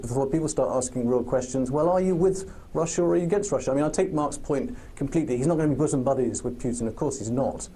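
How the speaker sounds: usual speaking voice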